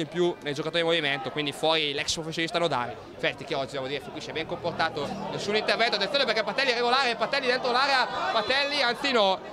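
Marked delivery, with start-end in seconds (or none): 5.3-9.4 rising, fast excited call; breathless goal commentary. Real, not acted. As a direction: voice climbing as the chance builds. (telecronaca concitata da gol)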